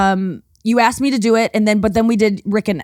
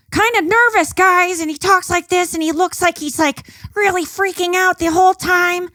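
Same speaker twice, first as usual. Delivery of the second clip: much higher, jittery nervous comic voice; Joe Mackey impression; 9 semitones higher than usual. Imitating a nervous stand-up comic for the host, pitched high. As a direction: play a comic who seems to be freaking out, pitched way up. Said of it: "That's a really good Joe Mackey".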